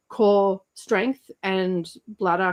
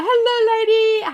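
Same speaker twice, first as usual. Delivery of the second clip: high, bright chat-greeting voice, much higher; sing-song greeting; 14 semitones higher, 6 dB louder, slower than usual. Pretend. Greeting a viewer from chat in a high sing-song. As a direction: lilt the hello up high.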